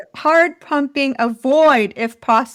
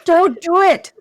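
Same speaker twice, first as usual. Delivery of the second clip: higher-pitched mock protest; imitating co-host's objection; 7 semitones higher, 3 dB louder than usual. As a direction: act out an exasperated telling-off. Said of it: "You said".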